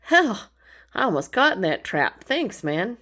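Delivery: a playful, startled, grateful bear; bear's relief. Pretend. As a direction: play the bear who nearly got caught. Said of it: "And the bear is like".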